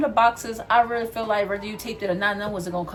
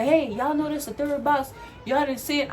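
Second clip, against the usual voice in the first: mock supervisor's higher-pitched reprimand; retold supervisor callout; 6 semitones higher than usual. Supervisor scolding workers over a missed retape, mock reprimand. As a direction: call the crew out, imitating a supervisor. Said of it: "You could say that in a different tone of voice".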